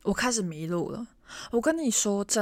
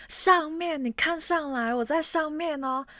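high, loud call from upstairs; pitched-up, loud calling out; 8 semitones higher, 3 dB louder than usual. Retold or imitated. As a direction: shout down to get noticed. Calling down to staff below.

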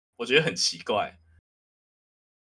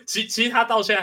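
laughing through the words, higher; words breaking into laughter; 10 semitones higher than usual. Real, not acted. Laughs about girlfriend's dislike. (边笑边说)

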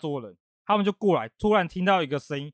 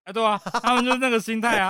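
higher, louder agreeing; excited agreement; 7 semitones higher, 3 dB louder than usual. Real, not acted. Agreeing eagerly with his friend's guess about the other table.

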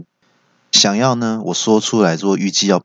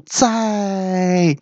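high, long-held syllable; drawn-out farewell; 11 semitones higher than usual. Acted. Patient slipping out starts to say goodbye, high and drawn out. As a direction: sing out one high, stretched word. Friend calls out interrupted goodbye.

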